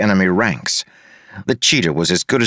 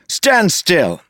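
high-pitched irritated command; exasperated order; 10 semitones higher than usual. A narrator's reading. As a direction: order a dodging foe to stop. Orders dodging opponent to stop.

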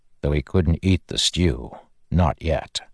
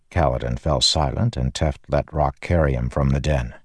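usual speaking voice

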